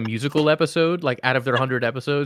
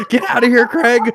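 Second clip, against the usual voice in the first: mock furious; 8 semitones higher, 7 dB louder than usual. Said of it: "typing away furiously".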